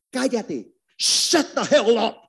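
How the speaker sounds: put-on mother on a plane silencing her teenage daughter, angry; mother snapping. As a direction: bark it at the daughter to shut her up. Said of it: "y decía".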